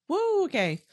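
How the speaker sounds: shrill high woo falling to okay. Real, not acted. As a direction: exhale the emotion out. Shaking off emotion.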